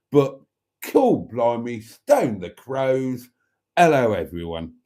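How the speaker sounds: drawn-out broad Cockney; exaggerated Cockney impression; Matron's voice. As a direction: play up the Cockney, stretching the exclamations. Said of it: "I should do the voice".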